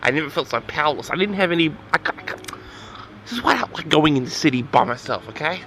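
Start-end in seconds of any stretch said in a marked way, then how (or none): none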